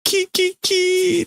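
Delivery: high squealing kee kee kee; a player mimicking a screeching car; imitated tyre squeal. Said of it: "The car is squealing here".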